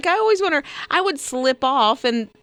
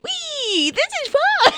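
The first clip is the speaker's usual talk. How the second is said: goat voice; slower than usual. Pretend. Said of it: "were they like".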